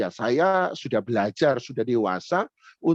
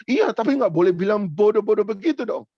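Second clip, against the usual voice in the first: put-on high, louder protesting kid voice; upset teen; 6 semitones higher, 4 dB louder than usual. The teen telling his leader not to call him stupid, upset. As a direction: push back like a hurt boy.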